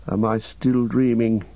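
ordinary delivery, nothing marked

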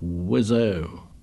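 lowish, hushed relish; bass-note delight. A narrator's reading. Ralph speaks to himself by the lagoon, deeply delighted. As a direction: savour it in a deep, contented undertone. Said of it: "sounding the bass strings of delight".

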